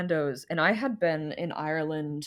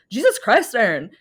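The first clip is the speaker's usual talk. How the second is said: loud, high-pitched bad Irish accent blurting a TV line; terrible Irish accent; 4 semitones higher, 7 dB louder than usual. Alone in a restaurant bathroom, quoting Derry Girls in a loud hammy Irish accent. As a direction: belt it out in a hammed-up Irish accent. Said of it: "a really terrible Irish accent".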